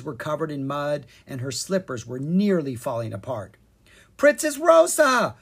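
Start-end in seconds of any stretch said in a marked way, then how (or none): 4.2-5.4 high, loud startled call; crying out. A narrator's reading. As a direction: call out the name in urgent surprise. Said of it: "Kara cried".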